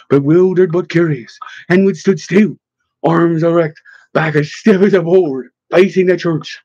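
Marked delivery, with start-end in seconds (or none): none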